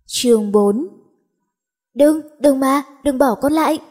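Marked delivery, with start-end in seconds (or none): 2.0-3.8 crying out in a nightmare. Acted. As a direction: beg not to be abandoned, frantic. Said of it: "Nó hét lên trong cơn ác mộng". (la hét van xin trong cơn ác mộng)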